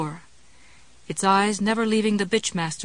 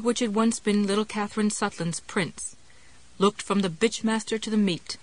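ordinary delivery

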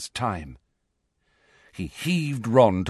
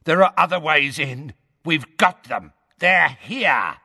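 higher, urgent villain calls; man's voice; 6 semitones higher, 3 dB louder than usual. A narrator's reading. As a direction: call to fellow pursuers. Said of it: "said a man's voice".

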